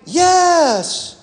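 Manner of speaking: put-on high, eager boys' cry; high excited kids' yes; boys exclaiming. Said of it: "the boys exclaimed".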